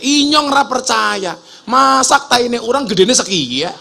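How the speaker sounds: high, drawn-out mock shout; loud Ngapak-dialect protest; disbelieving Ngapak yell. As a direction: imitate an incredulous Ngapak speaker yelling. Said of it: "Orang ngapak langsung teriak".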